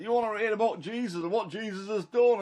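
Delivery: high, eager invitation to passersby; excited street preaching; eager calling out. Retold or imitated. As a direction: call out to strangers in the street, bursting with news. Calling out to crowds outside.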